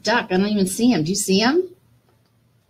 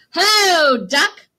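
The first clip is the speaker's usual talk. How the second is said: higher, louder sing-song hello; storybook duck hailing the dog; cheery duck greeting; 8 semitones higher, 6 dB louder, slower than usual. Acted. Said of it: "said Duck".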